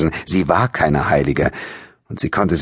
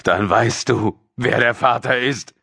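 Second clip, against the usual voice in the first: raised, insistent father's voice; angry pressing; 7 semitones higher than usual. A narrator's reading. Father demanding the baby's father from daughter, tense insistent questioning. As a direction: press her for the answer.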